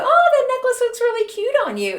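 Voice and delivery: high, sugary put-on sweetness; butter her up with sugary fake praise; fake sweet compliment. Fake compliment to suspected thief.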